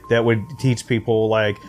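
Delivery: normal talking voice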